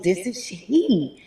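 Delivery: very slow and deliberate, falling; retold patient insistence. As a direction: spell it out to the caller. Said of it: "He goes".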